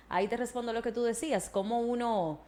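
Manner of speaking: ordinary talk, nothing marked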